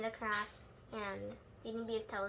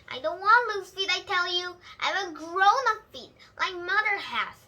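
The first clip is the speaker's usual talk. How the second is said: put-on higher, louder stubborn kid protest; indignant little girl; 6 semitones higher, 9 dB louder than usual. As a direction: stamp your foot in words. Child protests at dinner.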